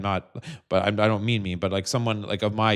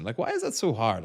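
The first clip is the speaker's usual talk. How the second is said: ordinary talk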